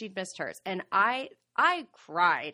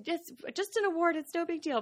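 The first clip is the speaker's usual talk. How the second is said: higher, lightly voiced daughter consoling; imitating her daughter; 10 semitones higher than usual. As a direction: mimic the daughter brushing it off. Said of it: "she's like".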